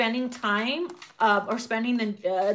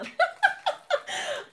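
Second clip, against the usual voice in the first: high laugh. Real, not acted. Laughing at card.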